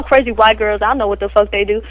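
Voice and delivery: ordinary delivery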